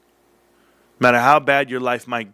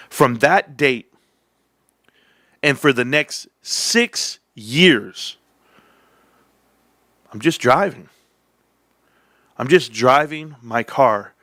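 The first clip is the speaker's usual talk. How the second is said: slow and drawn out; slower than usual. Real, not acted. Slow dramatic buildup of the story.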